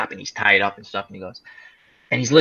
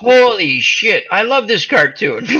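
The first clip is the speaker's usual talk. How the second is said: mock delighted shout; 6 semitones higher, slower than usual. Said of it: "I'm like".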